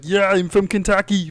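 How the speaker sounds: slow, high Austrian-accented Schwarzenegger impression; slow Austrian accent, a hammed-up Arnold. Mocking a Schwarzenegger movie line, slow Austrian accent. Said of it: "in every Schwarzenegger movie".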